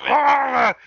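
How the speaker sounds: loud, high mock "aah" cry; sudden mimicked yell; imitated outburst. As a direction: let out a high wrestler's wail.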